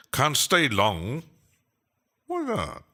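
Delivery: put-on playful British accent, high swooping pitch; British accent with big rises and dips; Judge Jervis. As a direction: put on a hammed-up British lilt. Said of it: "I'm sounding like Judge Jervis now".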